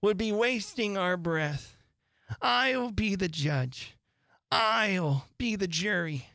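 slow, scheming pronouncement; cunning old Fury. A narrator's reading. Cunning Fury claims to be judge and jury, slow and scheming. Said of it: "said cunning old Fury".

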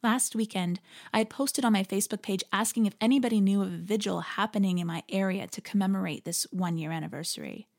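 ordinary talk

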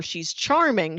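normal talking voice

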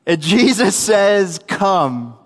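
slow and emphatic, dropping on "Come"; slow, deliberate repeat. Retold or imitated. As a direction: spell it out slowly for someone who misheard. Repeats the call after Peter mishears.

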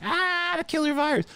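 high, panicked mock alarm. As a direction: squeal it like a scared crowd. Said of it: "Everybody's like".

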